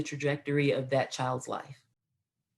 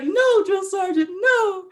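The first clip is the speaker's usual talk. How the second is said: very high, loud sobbing plea; hammed-up crying shout, stretched and pleading; imitated tearful wail; 6 dB louder, slower than usual. Crying recruit begging drill sergeant, imitated wailing plea. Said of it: "I just broke down and cried".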